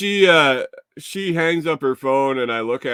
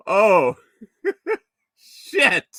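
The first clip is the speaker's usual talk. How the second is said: laughing, high startled curse; laughing oh shit; 9 semitones higher, 6 dB louder than usual. Real, not acted. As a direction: blurt it out mid-laugh. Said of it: "own reaction, laughing".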